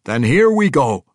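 loud, high dwarf voice; a dwarf king bantering with an old friend; gruff dwarf king. Acted. Bruner answering Athrogate, loud and hearty.

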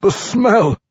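high, loud tormented dwarf; Pwent. A narrator's reading. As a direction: cry it out, tormented by temptation. Tormented vampire dwarf pleading.

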